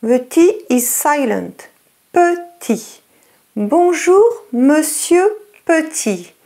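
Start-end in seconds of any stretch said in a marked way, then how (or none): none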